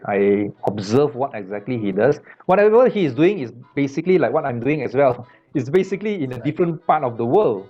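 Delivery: ordinary talk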